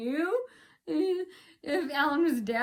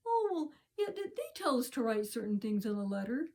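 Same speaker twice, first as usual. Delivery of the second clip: softer, backing-down voice; changed, meek tone; 6 dB quieter than usual. Retold or imitated. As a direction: imitate him suddenly going meek and soft. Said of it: "he changed his tone".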